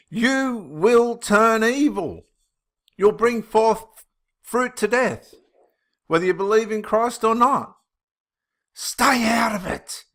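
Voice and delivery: high, slow sermon voice; fervent preaching. Acted. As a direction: preach it like a pulpit warning. Preaches warning against religion.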